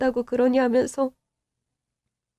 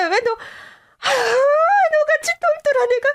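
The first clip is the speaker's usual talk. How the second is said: anguished cry; 9 semitones higher than usual. Acted. Mother anguished for daughter.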